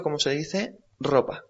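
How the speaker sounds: ordinary talk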